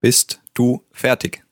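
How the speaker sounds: slow, word-by-word teaching delivery; measured language-teacher demonstration; slow model phrase. Shown as a demonstration. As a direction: space out "Bist du fertig" for a learner. Models question for learner to repeat.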